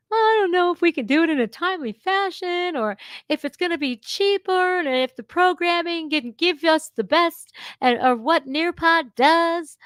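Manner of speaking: high, fast mimicking of a dithering board member; mock the board member's fussy voice, high. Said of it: "they were arguing back and forth".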